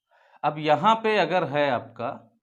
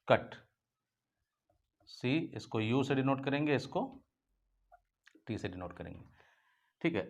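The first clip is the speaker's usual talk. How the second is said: ordinary talk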